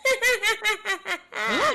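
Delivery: high cackling witch laugh; witch cackle. Acted. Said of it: "Do it again".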